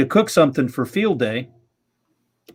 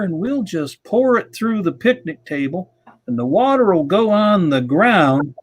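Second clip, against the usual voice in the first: slow, high, stretched-out Savannah drawl; imitating a friend's Savannah drawl, high and slow; 6 semitones higher than usual. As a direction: lay on a lazy Savannah drawl, unhurried and easygoing. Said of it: "in his Savannah drawl".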